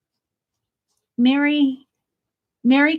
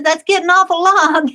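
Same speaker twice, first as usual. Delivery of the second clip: higher, louder playful character voice; snowman's mock complaint; 8 semitones higher, 6 dB louder than usual. Snowman ornament complaining about his nose, playful high voice. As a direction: tease it like the little snowman protesting. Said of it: "he says".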